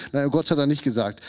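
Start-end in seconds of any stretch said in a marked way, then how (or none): none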